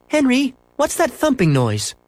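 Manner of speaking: very high, alarmed question; questioning mother. A narrator's reading. Mother asking son about thumping, alarmed. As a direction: pitch it high and insist on knowing.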